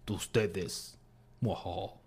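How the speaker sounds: low, quiet villain sneer; sneering panto villain. Retold or imitated. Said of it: "me suena más dramático".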